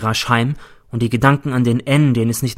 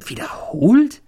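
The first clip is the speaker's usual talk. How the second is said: high breathless whispered echo; startled; 12 semitones higher, slower than usual. Acted. Teacher echoes Cäsar's murder plan, whispered disbelief. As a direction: gasp the word back in disbelief.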